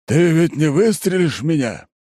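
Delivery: high, loud overblown pathos; hammy stage actor. A narrator's reading. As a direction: ham it up like a bad tragedian. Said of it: "непомерно пафосно произносит тот".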